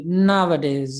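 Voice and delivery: Nowadays with NOW punched; NOW-uh-days, front-stressed; first-syllable stress. Shown as a demonstration. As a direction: hit NOW in Nowadays, let the rest drop. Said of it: "The stress is on the first syllable".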